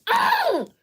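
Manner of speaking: put-on high, loud childish whine; whiny kid voice. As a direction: squeal it like an angry little kid. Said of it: "So you".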